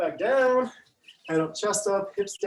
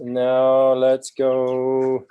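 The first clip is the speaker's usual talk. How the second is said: drawn-out hype call; 3 semitones lower, 8 dB louder, much slower than usual. Real, not acted. Starting the workout timer.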